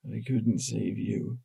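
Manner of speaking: soft, slow, barely there; faint whisper. A narrator's reading. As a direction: breathe it out, almost unheard. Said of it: "The whisper was so faint".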